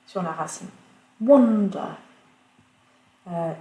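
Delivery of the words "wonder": "Wonder" with a loud first syllable; stress on first syllable. Shown as a demonstration. Teacher giving an example, "Wonder" stressed on the first syllable. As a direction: hit the start of "Wonder" hard, then fall away. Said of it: "accentué donc sur la première".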